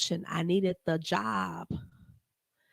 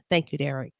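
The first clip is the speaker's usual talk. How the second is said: normal talking voice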